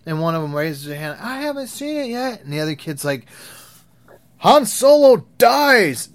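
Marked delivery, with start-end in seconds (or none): 1.2-2.4 lightly voiced kid, high and anxious; a kid worried about spoilers, voiced higher; kid's voice. Kid raising hand, worried. 4.4-6.1 put-on high, loud, slow kid's shout; a bratty kid shouting the spoiler to the class; blurted spoiler.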